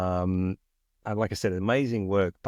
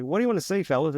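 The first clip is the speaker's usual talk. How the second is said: much higher, breezy show-off voice; cocky new guy; 8 semitones higher, faster than usual. Retold or imitated. New guy at the lighting board offering help to crew, breezy and cocky. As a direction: toss it off like you own the room. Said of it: "I'm like".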